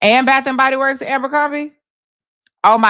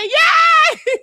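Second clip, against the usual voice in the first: much higher, laughing shout of "Yay!"; cheering; 3 dB louder than usual. Retold or imitated. Retelling her cheer at the craps table, laughing shout. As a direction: cheer loudly while everyone groans. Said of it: "so I said".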